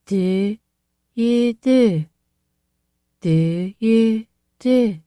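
put-on high, thick, weak girl's voice; a thick, feeble echo. A narrator's reading. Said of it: "a thick, weak voice".

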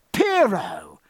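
high cry of glad recognition; delighted cry. A narrator's reading. Jack recognising an old shipmate's nephew, delighted cry. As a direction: call out in open pleasure. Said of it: "cried Jack, his look of cold reserve changing to open pleasure".

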